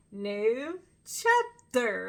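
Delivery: very slow, each word stretched; slow drawn-out. Retold or imitated. Fan imitating the singer's slow new chapter line. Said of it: "he kept saying it real, real slow".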